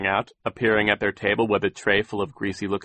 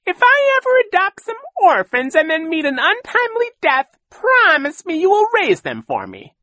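very high, loud mock woman's voice; hammed-up falsetto imitation, pleading; Josephine; 9 dB louder than usual. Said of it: "one day she said to me".

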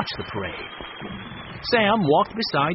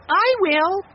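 put-on eager child; 6 dB louder than usual. A narrator's reading. Benny eagerly promises.

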